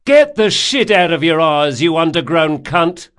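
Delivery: loud, high-pitched cursing yell; angry shout. A narrator's reading. Galley man abusing the scull's crew, angry shout. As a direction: hurl abuse down at a passing boat.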